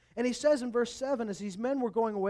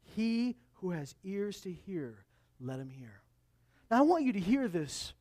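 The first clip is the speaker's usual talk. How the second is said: ordinary talk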